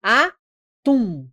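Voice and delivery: atum split as a, pause, tum; last syllable singled out. Shown as a demonstration. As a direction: set the tum of atum apart. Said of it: "Tum é a mais forte".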